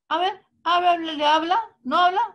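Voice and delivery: loud, high, insistent asking; mock doctor pressing a parent. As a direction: demand an answer from the child. Deaf man retells a doctor's question.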